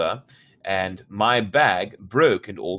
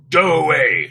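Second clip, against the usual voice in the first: louder menacing rasp; 7 semitones higher, 11 dB louder than usual. Acted. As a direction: push them away with a threat. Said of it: "she rasped".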